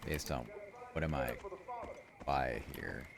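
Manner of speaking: unvoiced sneaky whisper. Real, not acted. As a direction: whisper it so nobody hears. Sneaking past enemies.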